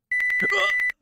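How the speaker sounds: a shocked, very high gasp; sharp gasp. Acted. Player seeing Button collapse, a high shocked gasp. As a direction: gasp in horror.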